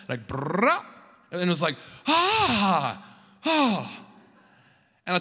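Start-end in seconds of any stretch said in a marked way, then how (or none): 0.3-0.8 rolled brrr swooping up in pitch; imitated crescendo. Preacher mimicking the sound in his ear, rising crescendo. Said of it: "it was like this crescendo". 2.1-4.0 high, open "ah" sliding down, twice; imitated release "ah". Man re-creating his ear opening up, high open ahs.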